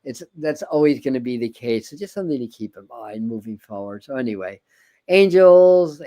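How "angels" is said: high, loud opening call. Acted. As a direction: belt the word out. Calling out angels, then fading.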